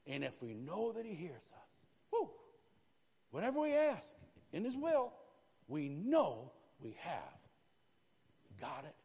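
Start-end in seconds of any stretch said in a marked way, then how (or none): 2.1-5.1 excited outburst. Real, not acted. Preacher bursts out mid-point.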